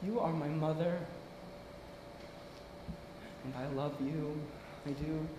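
very soft, low, tender admission; quiet sincerity. Acted. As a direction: say it hushed and low, meaning it. Tells mother he loves her.

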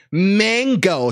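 "Mango" high, with a flat "man" vowel; exaggerated American pronunciation. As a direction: overdo American "MAAN-go".

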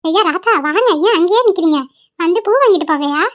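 put-on high, carrying call of an old flower seller; old granny calling out. Old flower seller calling out to a customer to buy flowers. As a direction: call the passer-by over like a granny.

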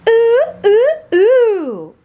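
high loud peeps; peeping baby chick. Acted. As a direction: cheep like a tiny chick. Imitating a chick.

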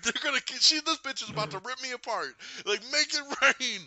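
high-pitched frantic pleading; mock panic. Thanos about to die as retold, mock panic. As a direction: imitate a villain losing his cool.

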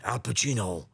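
put-on lightly done older Pacino rasp; a hint of today's Pacino; gently imitating the older actor; current Al Pacino. Shown as a demonstration. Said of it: "now Al sounds like this".

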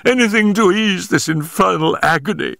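high, pained plea; agonised cry. A narrator's reading. Injured man pleads for relief from agony, high and desperate. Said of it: "he cried".

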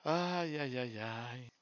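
weary, drawn-out sighs of "ay"; dejected sighs. Real, not acted. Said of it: "Es un bajón". (suspiros abatidos)

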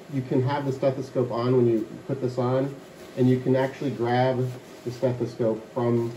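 enclosed head box. Real, not acted. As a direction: voice muffled by container.

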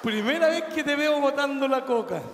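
high, loud laughing remark. Real, not acted. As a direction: talk through laughter. Laughing at him.

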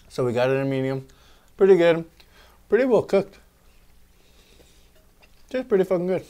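chewing while talking; talking between bites; mouth full. Real, not acted. Seen 1: Burger held at mouth, biting and chewing throughout the words.

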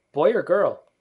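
put-on high, loud boyish exclamation; curious boy. A narrator's reading. Peter discovers a new child, loud high boy voice. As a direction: ask brightly loud, as a boy.

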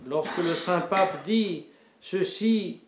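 ordinary talk

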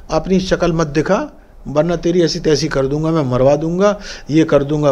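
ordinary talk, nothing marked

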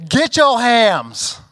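loud, high, slow mocking call; taunting yell. Teens taunting the people in line, loud slow jeer. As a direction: stretch it out as a jeer. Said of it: "They said".

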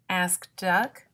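ordinary delivery, nothing marked

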